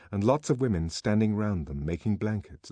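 plain reading voice

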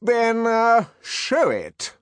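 taken aback but regal; majestic. Acted. Director challenging Bernard, taken aback yet majestic. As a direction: recover and command haughtily. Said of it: "but still majestically".

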